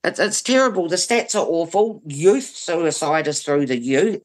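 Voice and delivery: ordinary delivery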